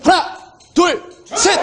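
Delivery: high, slow dramatic countdown; hyped countdown. Pretend. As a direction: count down to the sale. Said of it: "셋을 세면".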